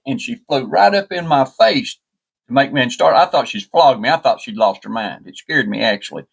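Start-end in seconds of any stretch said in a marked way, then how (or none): none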